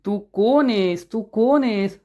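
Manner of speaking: slow, stretched wondering; drawn-out pondering. A narrator's reading. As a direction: draw out each word while trying to remember. Acted pondering over someone's identity. (आठवत, लांबवत विचारणं)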